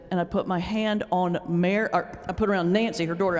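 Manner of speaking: usual speaking voice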